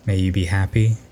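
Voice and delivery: ordinary talk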